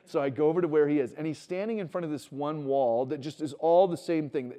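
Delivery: ordinary talk, nothing marked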